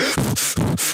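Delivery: sharp gasp on waking up; startled gasp. Retold or imitated. Streamer acting out waking from a night terror, gasping. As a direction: jolt awake with a sudden gasp. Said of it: "And I wake up like".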